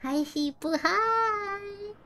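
bright high-pitched hello; excited high greeting. Real, not acted. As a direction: squeal a greeting. Greeting friend in game.

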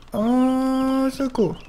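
high, frightened moans; high panicked cries. Acted.

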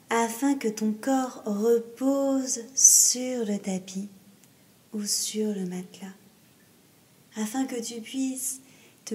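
slow Yoga Nidra voice, trailing into whisper; guided meditation. Acted. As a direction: ease the listener down with long pauses.

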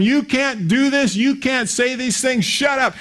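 high, slow mock tirade; woman yelling at the pastor on the phone; ripping into him. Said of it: "She started ripping into him".

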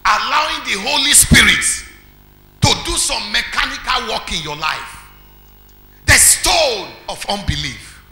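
shouted, high preacher's cry; preaching at full force. Acted. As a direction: thunder it out to the congregation.

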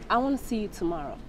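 quiet, firm ultimatum; threatening. Acted. Woman giving her boyfriend a deadline, quietly threatening. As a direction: lay down the threat softly, without wavering. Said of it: "what are you threatening me".

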